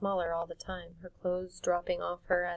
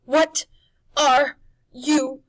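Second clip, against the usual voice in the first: high, loud crone shout; witch demanding; 10 semitones higher, 16 dB louder, much slower than usual. Acted. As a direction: demand it, rattled. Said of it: "she demanded".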